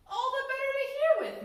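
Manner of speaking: high, loud mock granny voice; the wolf playing the sweet grandmother; disguised wolf.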